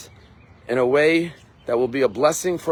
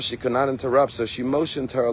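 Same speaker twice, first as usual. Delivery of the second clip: normal talking voice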